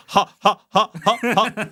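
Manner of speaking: high staccato ha ha ha; chanted taunting laugh; rhythmic mock laugh. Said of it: "laugh rhythmically and in unison".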